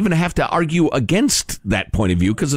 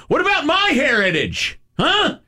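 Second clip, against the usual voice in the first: high-pitched, indignant protest without real volume; mock indignant shout; 10 semitones higher than usual. Imagined parent protesting to the French teacher, mock indignant shout. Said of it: "shouting at the French teacher".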